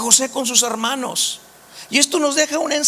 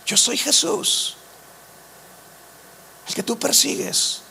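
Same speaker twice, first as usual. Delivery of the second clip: Jesús revealing himself; 4 dB quieter than usual. Acted. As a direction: say it softly, with a long pause midway. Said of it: "Jesús se le revela con su nombre".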